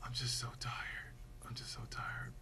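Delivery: soft, faint dying whisper; father whispering to his daughter as he dies. Retold or imitated. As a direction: breathe the words out like a last goodbye. Retold dying father's last words.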